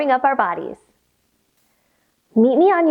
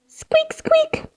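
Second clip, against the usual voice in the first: very high whispered squeaks; tiny breathy high squeaks; mouse squeaks; much slower than usual. Shown as a demonstration. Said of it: "Take a deep breath in and say".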